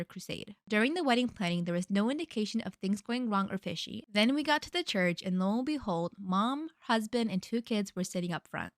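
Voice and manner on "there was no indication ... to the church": ordinary talk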